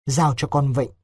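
ordinary talk

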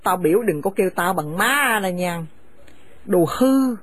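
harsh, contemptuous scolding of a daughter-in-law; bitter older woman driving her son's wife from the house; angry scolding mother. Acted. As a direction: spit the insults at her and order her out. Mother rejects son.

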